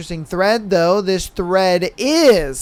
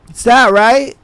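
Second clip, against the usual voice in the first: usual speaking voice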